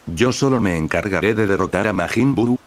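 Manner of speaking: usual speaking voice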